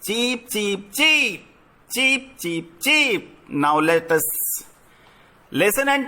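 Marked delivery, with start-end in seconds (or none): 0.0-3.5 small chirpy cheep cheep; chick cheeps. Shown as a demonstration. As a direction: imitate baby chicks cheeping. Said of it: "Listen to the baby chicks".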